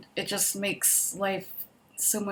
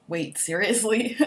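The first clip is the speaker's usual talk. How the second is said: drawn-out, slightly louder surprise; mock disbelief; 5 dB louder, slower than usual. Imitating people hearing about the hot dogs, incredulous. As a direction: imitate someone taken aback.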